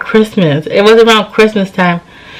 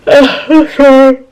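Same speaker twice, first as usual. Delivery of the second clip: higher-pitched, yawny apology; real yawning; 6 semitones higher than usual. Real, not acted. Tired vlogger excusing her yawning to viewers, yawny. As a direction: apologize through a yawn, voice higher. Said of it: "I keep yawning in this video".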